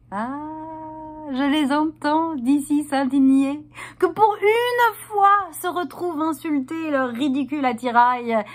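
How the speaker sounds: high, swelling theatrical protest; mock indignation. Performer imagining men's indignation, high and mocking.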